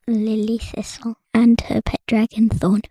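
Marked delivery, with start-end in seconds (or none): none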